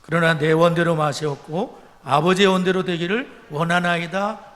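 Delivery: loud, solemn Bible reading from the pulpit; preacher reading the Gospel aloud; scripture reading. Acted. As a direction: proclaim the verses, slow and loud. Reads Gethsemane scripture aloud.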